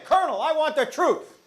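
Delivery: Tom Cruise demanding. Retold or imitated. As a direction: insist on the truth from the stand. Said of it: "Let's try that again".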